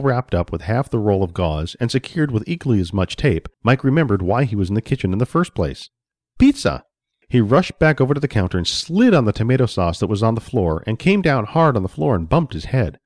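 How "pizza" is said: sudden high, loud burst; high-pitched eureka cry; excited exclamation. A narrator's reading. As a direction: exclaim it as a delighted realization. Mike remembers excitedly what he wanted.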